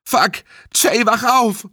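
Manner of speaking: high, frantic plea; desperate bellow. A narrator's reading. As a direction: yell at a friend to wake. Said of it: "brüllte Kyle". (verzweifelt gebrüllt)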